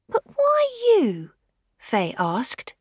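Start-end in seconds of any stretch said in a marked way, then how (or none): 0.1-1.3 put-on much higher, slow girl voice; young girl voice. Fay questioning the widow, high girlish voice. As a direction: ask like a puzzled schoolgirl.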